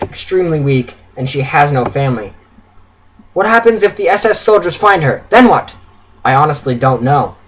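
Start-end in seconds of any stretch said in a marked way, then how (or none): 3.3-5.7 high, urgent wife's challenge; raised, worried arguing; alarmed confrontation. A narrator's reading. Wife confronts husband over the risk.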